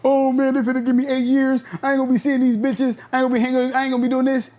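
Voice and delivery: imitating a guy crying over his sentence; mock crying. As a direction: whimper it like a crybaby, hammed-up. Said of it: "I wasn't crying like".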